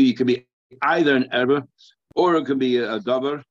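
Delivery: ordinary talk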